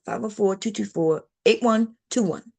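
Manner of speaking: quick, run-together number read-out; rushed habitual phone number; fast habit. Shown as a demonstration. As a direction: rattle the digits off the way you always do. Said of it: "I gotta say it fast".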